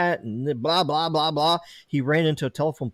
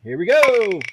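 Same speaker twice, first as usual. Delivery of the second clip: hyped giveaway announcement; 7 semitones higher, 5 dB louder, slower than usual. Acted. Wheel spin starts.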